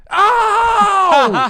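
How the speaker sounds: long, high-pitched mock shriek of pain; fake pain scream. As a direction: scream as the little sister faking an injury. Sister fakes being hit.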